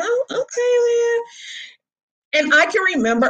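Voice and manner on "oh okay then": much higher, drawn-out mock acceptance; taken aback. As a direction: let it float up high, unconvinced. Taken-aback reply to friend.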